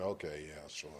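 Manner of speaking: imitating a sceptic's low, soft brush-off; mildly doubtful, humouring someone; dismissive. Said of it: "through the body language".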